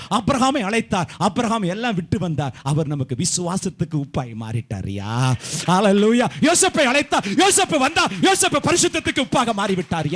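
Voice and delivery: building to high, loud cries; full-force preaching. Real, not acted. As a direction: proclaim with rising fervour to a shouted climax. (ஆவேசமாக உச்சக்குரலில் பிரசங்கம்)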